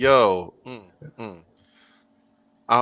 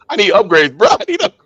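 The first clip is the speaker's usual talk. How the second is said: loud, high comic pleading; mock desperation; 7 semitones higher, 7 dB louder than usual. Manager justifying sales pitch to coworker, mock desperation. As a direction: plead loudly, climbing higher on the repeat.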